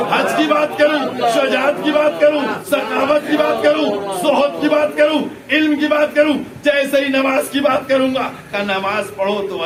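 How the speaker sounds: loud, high-pitched fervent sermon; full-force preaching. Real, not acted. Preacher urging listeners to follow Ali, impassioned shouted preaching. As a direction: pound out the list, building to shouted peaks.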